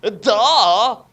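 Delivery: a shouted, cocky duh; loud duh. Acted. Character yelling at friends, loud duh. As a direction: blurt it out loud.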